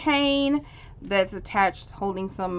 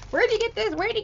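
excited squeal; 13 semitones higher, faster than usual. Real, not acted. Excited questions to crafter.

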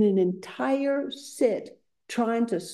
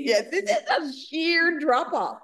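amused agreement; 8 semitones higher than usual. Acted. Echoes the quote while laughing.

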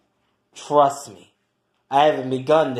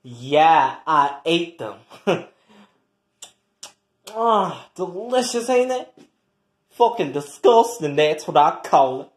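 ordinary delivery

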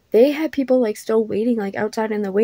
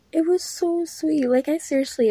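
gushing; 7 semitones higher than usual. Real, not acted. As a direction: melt over how sweet it was. Gushing repeat of same words.

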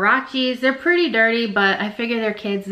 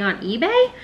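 accidental upward swoop at the end; voice going up; 4 semitones higher than usual. Real, not acted. Reseller quoting an online price to viewers, voice unexpectedly shooting up. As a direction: let "eBay" shoot up high like a question. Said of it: "my voice just went up like that".

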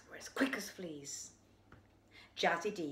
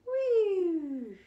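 gleeful whee; 10 semitones higher, 6 dB louder than usual. Acted. Weasel flies through the air.

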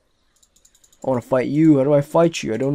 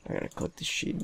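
whisper; 13 dB quieter, faster than usual. Real, not acted. Sneaking to collect.

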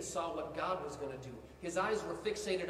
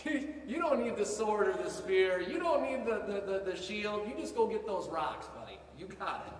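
smiling Saul; 3 semitones higher, 5 dB louder than usual. Retold or imitated. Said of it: "he's smiling like".

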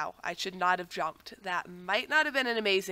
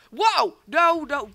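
startled yelp; 6 semitones higher, 8 dB louder, much slower than usual. Real, not acted. Falls into a trap.

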